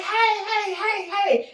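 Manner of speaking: high, loud repeated hails; excited calling out. Retold or imitated. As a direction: hail someone up on a balcony. Re-enacts hailing man on balcony.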